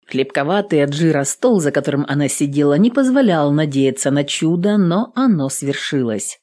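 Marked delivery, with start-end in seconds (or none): none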